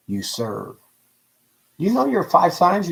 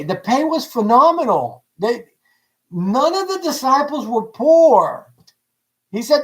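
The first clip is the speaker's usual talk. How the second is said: ordinary talk, nothing marked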